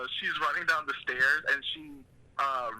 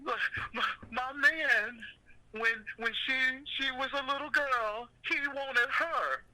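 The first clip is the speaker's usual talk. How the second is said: high-pitched mock Monique voice, slowing at the end; imitating Monique's character; 10 semitones higher than usual. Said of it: "And Monique is like".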